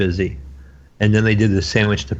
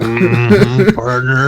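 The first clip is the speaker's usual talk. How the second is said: lightly imitated cowboy, louder; mock the co-host's cowboy drawl; jokey gunslinger voice, breaking into a laugh; Western cowboy; 3 semitones higher, 7 dB louder, much slower than usual. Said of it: "yours would have to be Western".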